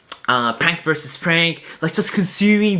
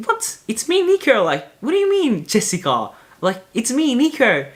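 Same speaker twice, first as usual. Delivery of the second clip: high, protesting it's me; high indignant what, it's me; 8 semitones higher than usual. Retold or imitated. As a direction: insist you're not your sister, voice up high. Protesting he is not Jessica.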